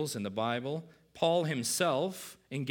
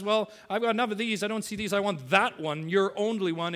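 higher, louder, fast entitled voice; self-absorbed; 7 semitones higher, 6 dB louder than usual. Retold or imitated. Preacher voicing the rich man, louder and fast, self-absorbed. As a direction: grab what you want without a care. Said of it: "just so into himself".

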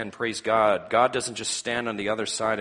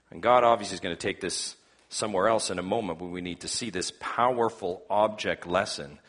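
normal talking voice

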